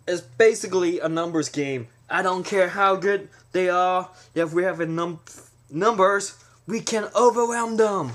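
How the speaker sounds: hammed-up mob boss working himself up; boss ranting. Mob boss to his wife about numbers, rising shout. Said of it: "and he's going".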